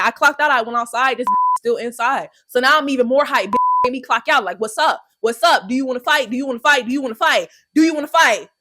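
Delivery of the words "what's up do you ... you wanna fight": usual speaking voice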